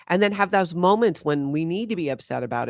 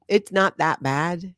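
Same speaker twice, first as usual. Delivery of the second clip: usual speaking voice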